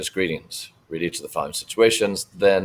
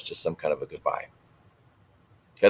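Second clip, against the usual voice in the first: ordinary delivery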